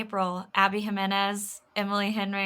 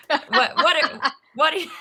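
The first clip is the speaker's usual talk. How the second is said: teacher voice breaking into laughter; laughing teacher voice; 6 semitones higher, 4 dB louder than usual. Shown as a demonstration. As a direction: try the teacher line, cracking up. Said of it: "I can't not do it now".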